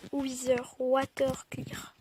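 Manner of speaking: quiet, slow English accent; mock English accent. Shown as a demonstration. Gamer repeating a command to himself, hushed English accent. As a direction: murmur it slowly with an English accent. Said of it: "Avec mon superbe accent anglais".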